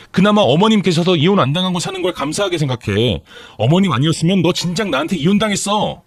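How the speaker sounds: high, heated acted tirade; outraged wife building from disbelief; furious yelling. A narrator's reading. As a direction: hurl it back at the husband. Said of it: "라고 소리를 질렀죠".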